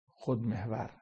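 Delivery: normal talking voice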